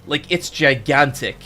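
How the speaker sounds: ordinary delivery, nothing marked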